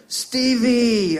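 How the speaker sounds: loud, high, delighted dad call; imitated dad's greeting. Dad hearing his son on the phone, imitated exuberant shout. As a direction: cry out the pet name, high and loud. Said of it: "my dad would always go".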